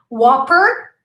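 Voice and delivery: usual speaking voice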